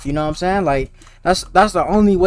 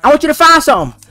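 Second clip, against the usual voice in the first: high, loud playful taunt; loud teasing; 7 semitones higher, 6 dB louder than usual. Real, not acted. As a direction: rib the player at full volume. Teasing a player loudly.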